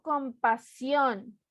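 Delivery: compaSIÓN with a long, stressed SIÓN; stress on last syllable. Shown as a demonstration. Teacher showing the right one, stress on final SIÓN. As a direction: lean slowly on the SIÓN of compasión. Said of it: "Última sílaba".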